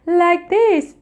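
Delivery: high, light cartoon voice. Shown as a demonstration. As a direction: sing it like a cartoon character. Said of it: "use the cartoon voice".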